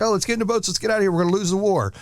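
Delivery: mock quitter. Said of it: "once you're like".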